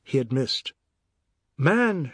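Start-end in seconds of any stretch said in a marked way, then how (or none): 1.6-2.1 higher-pitched protesting cry; exasperated cry. A narrator's reading. Wife pulling husband from window, exasperated cry. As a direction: cry out to stop him. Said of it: "Sharma cried".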